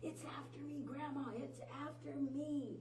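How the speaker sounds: high frightened cry, half-acted; retold scream. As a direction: cry out that it's after you. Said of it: "screaming at the top of her lungs".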